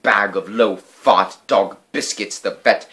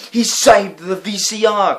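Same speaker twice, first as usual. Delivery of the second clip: loud, high, slow joyful cry; excited cry; 12 semitones higher, 6 dB louder than usual. A narrator's reading. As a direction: exclaim it with delight. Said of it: "cried Father".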